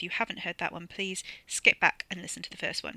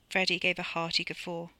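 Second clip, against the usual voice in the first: usual speaking voice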